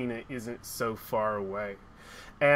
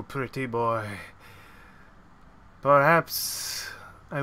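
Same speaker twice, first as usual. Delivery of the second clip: sly villainess; 2 semitones lower than usual. Acted. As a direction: toy with him like a cruel queen. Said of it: "she'll look at you, and she'll say".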